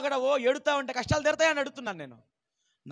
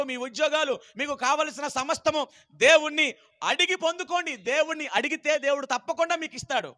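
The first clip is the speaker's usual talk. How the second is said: fervent preacher exhorting, higher and louder; rally the youths to ask God; preaching; 4 semitones higher, 4 dB louder than usual. Real, not acted. Preacher urging the congregation to pray, fervent.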